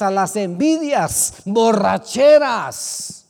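loud, high shouting exhortation; preaching at full force. Acted. Pastor warning congregation against old ways, shouted preaching. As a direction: warn the church with all your force.

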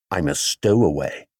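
bear's confession. A narrator's reading. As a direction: admit it simply in the bear's voice. Bear confesses to Mister Brown.

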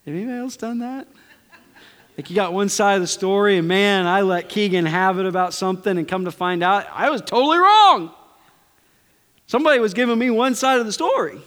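self-mocking excited retelling; animated confession. Real, not acted. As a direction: build it up like a funny confession. Excited confession of parenting mistake.

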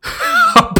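high burst of laughter; loud laugh. Real, not acted. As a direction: crack up. Reacts to bike cops.

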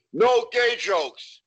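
drawn-out prissy demand, lightly acted; voicing the wife. Client's wife laying down the rule, slow mock voice.

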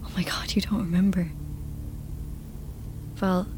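unvoiced horrified whisper. A whispered gasp at Val's blood. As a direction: gasp it out under breath.

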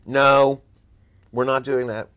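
loud, firm no to a star client; firm refusal. Retold or imitated. Agent refusing a famous client, loud and firm. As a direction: shut the request down hard.

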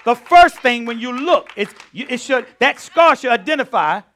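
loud, high preaching cry; preaching at full force. Acted. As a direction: hammer each phrase to the crowd.

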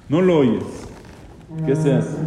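ordinary talk

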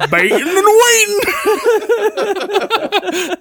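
laughing, with "waiting" squeaked up high. Real, not acted. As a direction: giggle the pun back at them. Repeating the pun while laughing.

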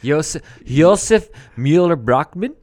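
Josef Mueller-Brockman loud, in a misplaced Swedish-like accent; wrong accent. Shown as a demonstration. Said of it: "I did it with the wrong accent".

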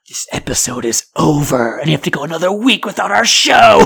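dramatic doom voice rising to loud; mock horror announcer. Host telling listeners the show is over, mock dread. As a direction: announce the end like a terrible fate.

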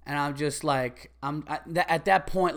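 usual speaking voice

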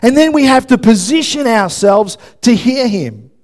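loud, high sermon voice; preaching at full force. Real, not acted. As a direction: proclaim it emphatically to the whole room.

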